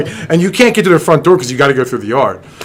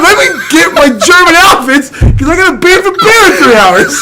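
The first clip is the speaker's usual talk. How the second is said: higher, louder, cracking up through the words; laughing; 14 semitones higher, 5 dB louder than usual. Pretend. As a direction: barely hold it together while joking. Joking line through laughter.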